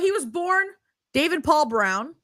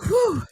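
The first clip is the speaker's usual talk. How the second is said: high exhausted "whew"; imitated puff; 9 semitones higher than usual. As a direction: mimic a winded exhale. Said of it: "He's like".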